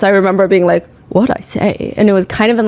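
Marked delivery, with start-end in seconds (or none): 1.1-1.9 lightly imitated Southern accent; a mild Southern lilt on a retold question. Lawyer questioning a witness in court, lightly imitated Southern accent. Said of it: "like, a Southern accent".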